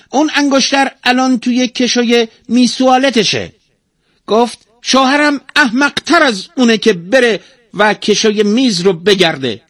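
very high, loud parrot voice; high-pitched, indignant tale-telling bird; talking parrot, aggrieved. A narrator's reading. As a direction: squawk out your complaints as a tattling parrot. Narrator plays the talking parrot.

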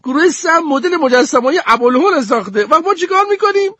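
put-on very high, exasperated girl voice; annoyed. Goldie complains angrily about the group's project, very high. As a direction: spit out the complaint, fed up. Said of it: "با حرص گفت".

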